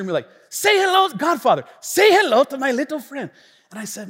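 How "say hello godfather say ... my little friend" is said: high, loud bad Cuban-American accent; mock Cuban-American gangster. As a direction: imitate a hammed-up Cuban-American movie gangster. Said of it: "like a gangster".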